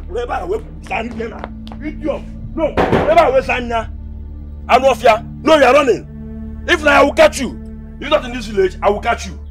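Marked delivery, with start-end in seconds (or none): 2.5-7.5 loud threats hurled after someone; angry shouting. Acted. Chasing off a rival in a village quarrel, angry shouting. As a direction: shout it as a furious warning.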